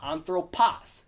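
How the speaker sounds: anthroPOS, weight on "pos"; stress on last syllable. Shown as a demonstration. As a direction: misplace the stress onto the end of "anthropos". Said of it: "It's not anthropos".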